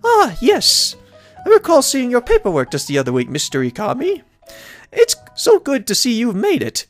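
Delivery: high-pitched, welcoming lady mayor voice; pitched well up, pleased to see the newcomer; cheerful mayor. Acted. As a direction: voice a delighted mayor greeting a newcomer.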